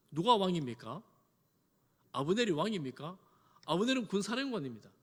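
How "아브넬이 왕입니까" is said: normal talking voice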